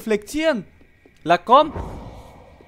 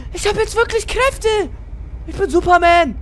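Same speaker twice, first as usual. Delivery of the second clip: excited shout; 12 semitones higher than usual. Acted. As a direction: crow about your new powers. Boasting about new powers.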